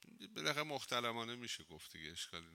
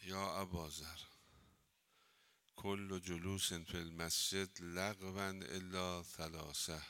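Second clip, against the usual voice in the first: slow, measured Arabic reading; hadith recitation; 3 semitones lower, slower than usual. Acted. Teacher reading the hadith to students, slow recitation. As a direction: recite each phrase deliberately.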